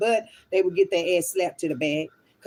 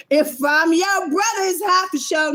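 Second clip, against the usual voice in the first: put-on higher and louder, teasing a kid who says bruh; mock teen retort; 9 semitones higher, 6 dB louder than usual. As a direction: throw it back at the kid, loud and high. Mocking kids' bruh talk.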